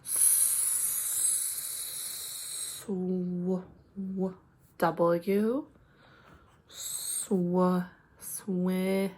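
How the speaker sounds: Sw- hissed softly, then swi- stretched; sounding out words. Shown as a demonstration. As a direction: sound out Sw- slowly and quietly. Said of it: "so I'm gonna go slow".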